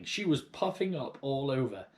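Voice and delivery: ordinary delivery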